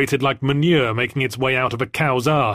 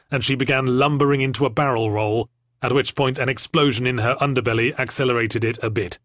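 usual speaking voice

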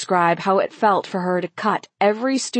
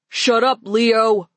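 higher, irritated retort; irritated snap; 3 semitones higher, 3 dB louder, slower than usual. A narrator's reading. Boys silencing Leo as one, irritated. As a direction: cut him off, annoyed. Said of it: "the boys all said as one".

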